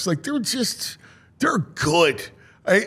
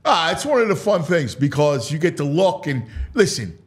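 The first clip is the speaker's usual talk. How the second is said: usual speaking voice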